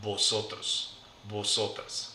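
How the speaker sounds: "vosotros, vosotras" with each initial v said as a b; V pronounced as B. Shown as a demonstration. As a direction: softly model a b sound at the start of "vosotros, vosotras". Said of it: "pronounced like a B".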